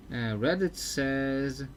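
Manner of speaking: ordinary talk, nothing marked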